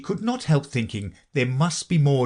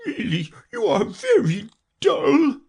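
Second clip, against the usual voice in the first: high, cross creature wail; Mock Turtle; 3 dB louder than usual. A narrator's reading. Mock Turtle scolds Alice for asking, high and angry. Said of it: "said the Mock Turtle angrily".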